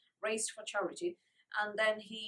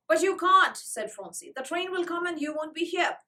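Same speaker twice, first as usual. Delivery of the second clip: loud, high pleading; distressed protest; 7 semitones higher, 8 dB louder than usual. Acted. Little girl begging Joel not to leave.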